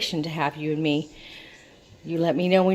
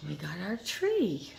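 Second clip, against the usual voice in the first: ordinary talk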